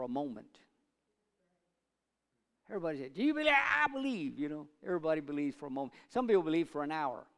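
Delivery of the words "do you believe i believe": mock high chirpy. Mimicking passing momentary faith.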